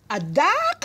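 high-pitched, rising disbelief; incredulous shout. A narrator's reading. General hearing what is in the gun, incredulous exclamation. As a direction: exclaim it as a high, stunned question. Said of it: "shouted the general".

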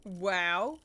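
loud amazed wow. Real, not acted. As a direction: blurt out in awe. Gameplay reaction.

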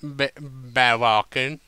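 slow, slurred and stumbling; slow drunken answer. A narrator's reading. Applejack answering Rarity while drunk, slow and slurred. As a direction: drag the words out tipsily. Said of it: "Applejack said slowly".

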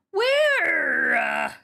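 high, loud, stretched-out cry; incredulous yell. Real, not acted. Player told of a man in the camera, incredulous yell. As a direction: yell it in drawn-out disbelief.

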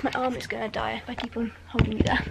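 whispered aside; whispering. Real, not acted. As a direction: whisper it under the breath. Aside while putting camera down.

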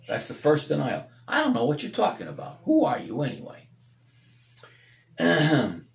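fervent preacher, rising and falling; preaching. Acted. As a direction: preach it out to the congregation. Preaching at full force.

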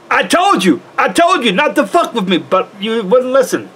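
high, mock furious gangster; pissed-off Tony Montana. Boy imitating Tony Montana getting pissed off. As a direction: bite off each I told you. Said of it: "he gets pissed off and says".

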